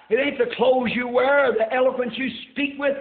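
preacher building to a high cry; impassioned sermon swell; full-force preaching. Real, not acted. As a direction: drive it home to the congregation, rising.

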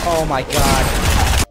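loud, slow stretched awe; hyped gamer bro. Real, not acted. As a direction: drag it out in disbelief. Hyped reaction to fight.

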